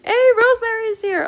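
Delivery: high and loud happy hello; light up as she walks in; excited greeting. Real, not acted. Greeting someone arriving.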